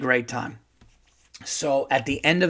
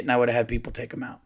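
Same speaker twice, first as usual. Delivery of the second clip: ordinary talk, nothing marked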